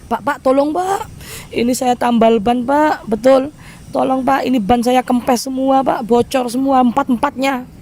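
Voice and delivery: high-pitched imitated plea for help; urgent pleading. Stranded driver knocking at the tyre patcher's at night, pleading. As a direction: beg for help, pitched well up.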